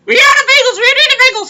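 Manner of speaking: very high, loud nagging wife voice; wife yelling at her husband to fetch bagels; shrill nagging wife. Retold or imitated. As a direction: screech the order like a nagging wife. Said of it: "She said".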